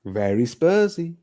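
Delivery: sneering Spurs fan impression, drawn out; mock Spursy fan. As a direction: drag it out in a put-on sneer. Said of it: "'Cause that's how we sound".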